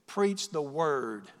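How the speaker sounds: very slow, weighty delivery; emphatic command. Acted. Preacher charging believers to preach, solemn and emphatic. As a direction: give each word its own weight.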